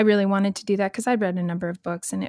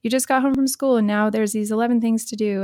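higher, louder, fast list of chores; rushed parent; 3 semitones higher, 5 dB louder, faster than usual. Retold or imitated. Busy parent hurrying the kids after dinner, rushed and louder. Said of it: "we so quickly go to".

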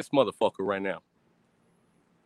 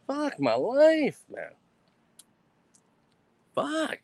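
mock despair; 7 semitones higher, much slower than usual.

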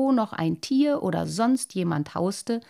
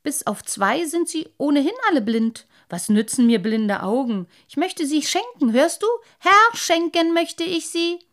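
higher, gloating fish voice; spiteful; 8 semitones higher, 6 dB louder than usual. Acted. Lamprey gloating after the boy, spiteful. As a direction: gloat and call after him. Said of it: "hämisch".

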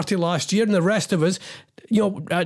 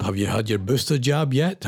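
normal talking voice

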